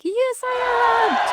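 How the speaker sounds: delighted high-pitched yes; proud high yes. Retold or imitated. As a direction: squeak it out proudly. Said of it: "I was like".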